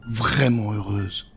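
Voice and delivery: ordinary delivery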